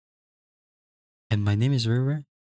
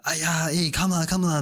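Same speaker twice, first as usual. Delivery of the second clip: lightly hammed-up Singlish, higher pitch; tossing off a playful higher come lah in Singlish; 6 semitones higher than usual. Streamer shows chat her Singlish accent, playful and higher. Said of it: "I'll be like".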